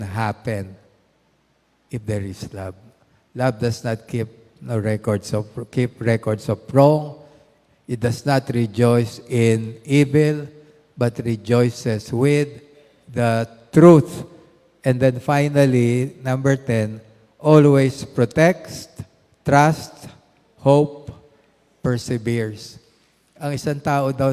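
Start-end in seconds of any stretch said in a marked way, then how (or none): 3.3-14.3 loud, slow preaching of the verse; fervent Bible reading; scripture recitation. Acted. 17.4-21.1 each word slow and loud; strong preacher's list; emphatic list. Acted. Listing love's traits.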